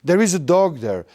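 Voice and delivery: ordinary talk, nothing marked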